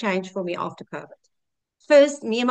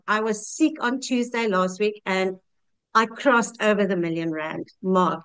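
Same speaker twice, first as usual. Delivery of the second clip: normal talking voice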